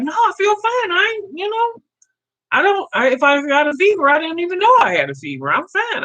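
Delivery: retold insistence. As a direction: act out assuring the doctor you're okay. Retold protest to the doctor.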